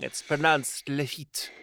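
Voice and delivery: Lafitte said the French way, la-FEET, slowly; drawn out, careful Lafitte; French pronunciation. Shown as a demonstration. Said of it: "native tongue".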